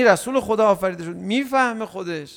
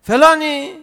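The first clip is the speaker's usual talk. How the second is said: loud, higher, disrespectful call; rude calling out; 8 semitones higher, 6 dB louder, slower than usual. Retold or imitated. People rudely calling the Prophet, loud call. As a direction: shout a name across the courtyard. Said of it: "من ادا و اینهاشو در نیارم".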